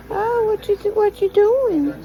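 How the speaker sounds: ordinary talk, nothing marked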